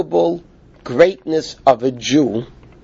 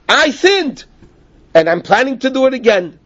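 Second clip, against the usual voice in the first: high, offhand mock admission of sin; unrepentant confession; 7 semitones higher than usual. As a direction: say it as if you'll sin again anyway. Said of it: "A guy could say".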